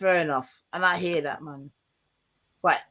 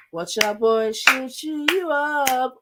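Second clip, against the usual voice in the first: hyped mock announcer; 9 semitones higher, 3 dB louder, slower than usual.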